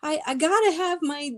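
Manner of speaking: put-on high, loud, childlike begging; whiny pleading. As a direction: beg like a kid losing her toys. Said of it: "and I'm like".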